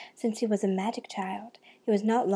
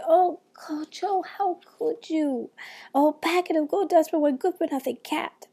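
crying out in dismay; 6 semitones higher, 3 dB louder than usual. Acted. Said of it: "she cried".

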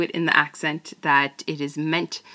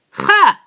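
mocking laugh; 11 semitones higher, 12 dB louder than usual. Captor mocks the spy.

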